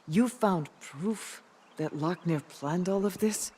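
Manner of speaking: ordinary delivery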